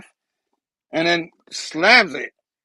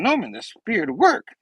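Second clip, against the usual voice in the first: higher, lightly voiced earnest exclamation; mildly excited, more natural delivery; Peter; 6 semitones higher than usual. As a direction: report it brightly without hamming it up. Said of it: "hold on. It's Peter going".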